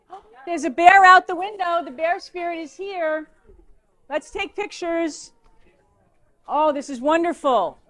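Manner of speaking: a teacher spots a bear through the window, thrilled; real excitement. Real, not acted. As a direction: gasp at the bear outside. Bear appears outside window.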